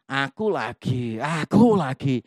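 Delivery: slow, drawn-out mock grumble; slow imitation of someone fed up; mock weary complaint. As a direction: drag it out like a put-upon volunteer. Mimics self-important weary server.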